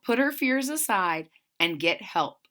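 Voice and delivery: ordinary delivery, nothing marked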